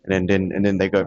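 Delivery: ordinary talk, nothing marked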